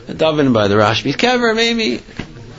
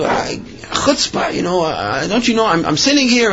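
imitated scolding in a raised pitch; retold yelling; 5 semitones higher than usual. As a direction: act out an outraged passenger's tirade. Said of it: "he starts yelling at her".

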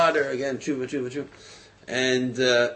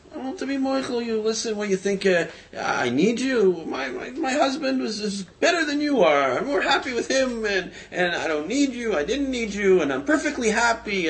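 high, mocking and dismissive, raised well above the voice; scornful refusal; 10 semitones higher than usual. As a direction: brush him off with a laughing sneer. Ex-fiancée refuses to forgive.

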